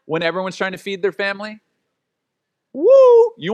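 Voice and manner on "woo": high, loud whoop; excited whoop. Retold or imitated. Describing the chaos at In-N-Out, loud whoop. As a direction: let out a big whoop. Crowded In-N-Out line exclamation.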